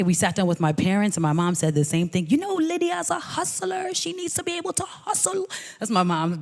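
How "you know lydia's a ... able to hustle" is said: high, proper Jamaican mother's voice, softer; prim Jamaican mother urging her daughter on; mom's Jamaican accent. Retold or imitated. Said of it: "Is that her accent?".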